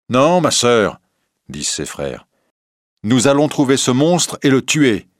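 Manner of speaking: brothers' vow. Acted. Brothers vow to kill monster.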